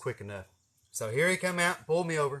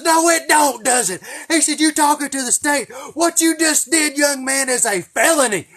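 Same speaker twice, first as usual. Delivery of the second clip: furious trooper yell; 13 semitones higher, 13 dB louder than usual. Retold or imitated. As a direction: roar it like a trooper lecturing a punk. Trooper yells at teen.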